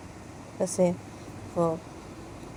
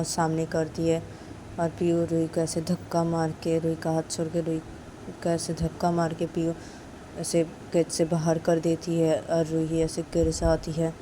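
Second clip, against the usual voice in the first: usual speaking voice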